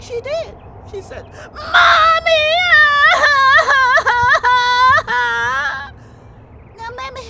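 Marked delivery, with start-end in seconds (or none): 1.5-5.9 loud high wailing; crying. Acted. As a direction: wail like a hurt child. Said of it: "Lee Lee Goro was crying".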